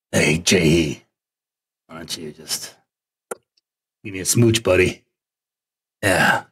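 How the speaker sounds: low, goofy put-on wooing voice; creepy sweet-talker. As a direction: coax a kiss in a low, silly voice. Puts on a smooching creep voice.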